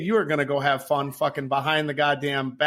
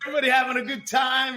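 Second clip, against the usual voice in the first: higher, sing-song crowd-pleaser voice; fake cheery captain; 10 semitones higher than usual. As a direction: sell the fun to the customers. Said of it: "I'm like".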